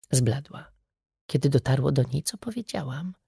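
usual speaking voice

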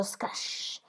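soft voiceless snake hiss; imitated serpent hiss trailing off.

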